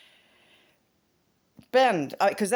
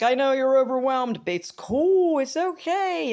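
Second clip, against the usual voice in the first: reassuring; 9 semitones higher, 5 dB louder, slower than usual. Retold or imitated. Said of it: "giving Bill Knott some tips like".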